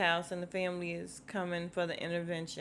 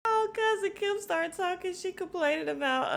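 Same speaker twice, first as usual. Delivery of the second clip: excited; 10 semitones higher than usual. Real, not acted. Excited announcing next scene.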